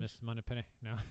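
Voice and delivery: soft, tentative Scottish impression; Sean Connery. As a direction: try out a quiet Scottish movie-spy voice. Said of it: "I need my Sean Connery".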